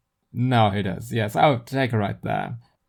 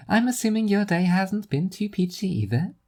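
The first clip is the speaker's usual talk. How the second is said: higher, teasing friend; Zarya's teasing voice; 9 semitones higher than usual. Acted. Zarya teasing Seth about his bad day, high voice. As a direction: rib him gently, pitched up.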